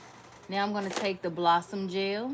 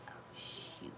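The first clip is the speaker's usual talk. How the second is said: whispered; 16 dB quieter than usual. Real, not acted. Quiet slip-up under breath.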